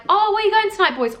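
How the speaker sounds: high, singled-out call to passing lads; flirty calling out.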